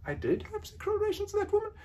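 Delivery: high, quick, soft echo; repunctuated echo. Shown as a demonstration. Analyst to Clinton, replaying his words minus the not. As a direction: float the line back without the not. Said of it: "by questioning the not".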